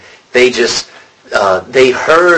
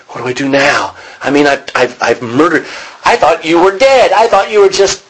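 desperate Paul; 7 semitones higher than usual. Retold or imitated. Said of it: "is what he's asking".

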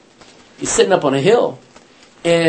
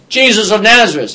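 crowd announcing; 5 semitones higher, 8 dB louder than usual. Retold or imitated. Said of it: "they all announce".

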